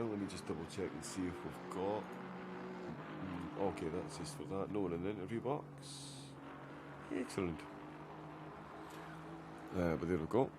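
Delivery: low, soft absent-minded aside; talking to himself. Real, not acted. Commentator double-checking the chat, quiet aside to himself. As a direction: think aloud quietly while checking.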